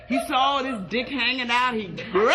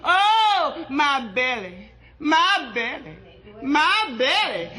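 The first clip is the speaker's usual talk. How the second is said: hollering in pain; 2 semitones higher, much slower than usual. Acted. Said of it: "she was just hollering".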